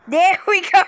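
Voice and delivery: loud high triumphant call; excited cheer. Real, not acted. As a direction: cheer it out, a win. Success in game.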